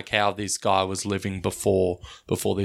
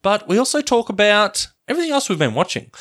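big, loud show-opening delivery; podcast intro announcer; 7 semitones higher, 6 dB louder than usual. Acted. Host opening a movie podcast to listeners, announcer voice. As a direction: welcome listeners like an excited announcer.

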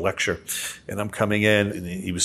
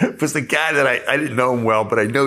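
laughing; 5 semitones higher, 4 dB louder than usual. Real, not acted. Laughing while retelling the sting.

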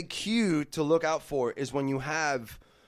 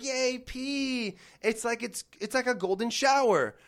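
higher, mock childlike glee; cheering kids; 6 semitones higher, slower than usual. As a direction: squeal like delighted cartoon kids. Kids voiced in cartoon.

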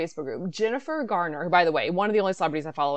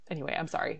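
normal talking voice

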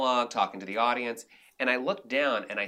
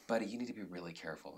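hushed warning; 9 dB quieter, faster than usual. Retold or imitated. Agent pulls him aside, hushed.

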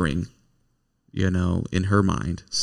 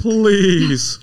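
pleading, higher and louder, in a put-on Mister Ed voice; mock prayer like Mister Ed; 11 semitones higher, 5 dB louder than usual. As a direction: beg God like a talking horse. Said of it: "Sound like Mister Ed".

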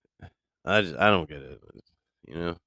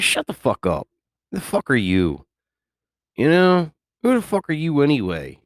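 higher-pitched, hammed-up indignation; mock indignant comeback; 8 semitones higher, 3 dB louder than usual. Podcaster acting out a comeback to nosy coworkers, mock indignant. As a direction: throw it back at them in mock outrage. Acts out snapping back at coworkers.

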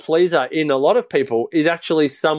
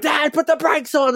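urgent plea; 11 semitones higher than usual. Retold or imitated. Toboggan rider warns Dad to brake, mock-urgent and high.

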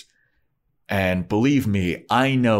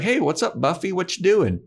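much higher, bright innocent Willow voice; chirpy Willow; 10 semitones higher than usual. Retold or imitated. Willow greets Buffy, hiding her witchcraft, higher and chirpy. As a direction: play it breezy and high like nothing is wrong.

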